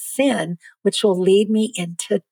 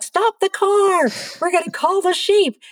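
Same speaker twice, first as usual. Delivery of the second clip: high, eager call to stop the car; excited call; 11 semitones higher than usual. Retold or imitated. To friends at the ranch, high excited call. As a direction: squeal it like a thrilled tourist.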